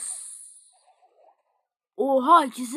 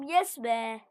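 usual speaking voice

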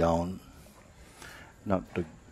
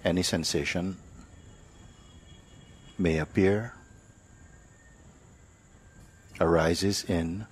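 guided meditation; 4 dB quieter than usual. Acted. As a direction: ease them into stillness, one phrase at a time. Teacher leads silent meditation.

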